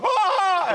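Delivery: mock shriek, very high and loud; a startled squeal at a dinosaur; high-pitched scream. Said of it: "in a high-pitched voice".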